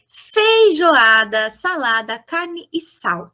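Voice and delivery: slow, deliberate reading of a word list; slow list reading for the class; reading slowly. Shown as a demonstration. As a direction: read each word slowly aloud. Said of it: "Vamos observar?".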